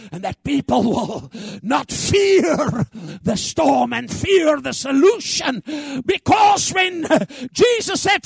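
loud, high, shouted sermon climax; preaching at full force. Acted. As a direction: roar it to the whole church.

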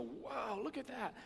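mock wonder at a sight; amazed. Preacher acts out seeing something shiny, amazed.

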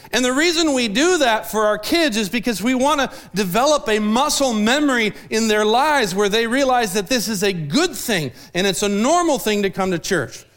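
normal talking voice

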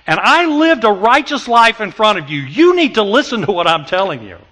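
Paul pressing the people to listen; Paul insisting. Retold or imitated. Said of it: "it's almost as if Paul is saying".

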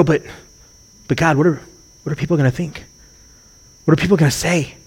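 softly voiced, halting doubt; timid mock objection to God, softened; acted hesitant worry. Preacher voicing us balking at God's call, hesitant worry. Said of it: "And we go".

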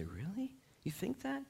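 high, soft sceptical questioning; incredulous. Acted. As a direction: question it softly, pitch climbing in disbelief. The Lord doubting people's judgment.

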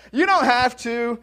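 loud, slow fatherly correction; dad's stock reply. Retold or imitated. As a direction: lay it down like a stern dad. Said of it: "Somebody else heard my dad speak".

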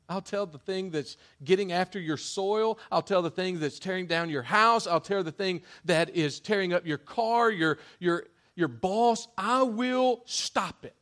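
loud, high-pitched promise, building; climactic preaching. Acted. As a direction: swell into a triumphant promise.

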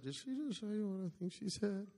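very soft near-whisper, acted out; retold stunned whisper to his wife; hushed aside. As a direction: lean over and ask under your breath. Confiding aside to wife at table.

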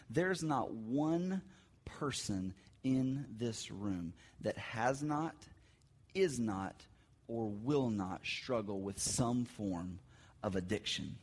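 quiet, grave, drawn-out emphasis; solemn slow emphasis. Real, not acted. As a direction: weigh every word, deliberately. Solemn sermon point.

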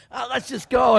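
loud, high excited urging; gung-ho. Retold or imitated. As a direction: wave it through with loud eagerness. Retelling own gung-ho reply.

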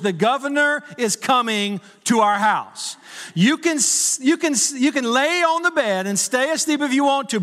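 hammed-up cross wife; mock angry wife. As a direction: push it up, a stubborn half-asleep wife. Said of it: "She's getting angry with me".

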